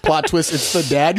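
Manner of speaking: ordinary talk, nothing marked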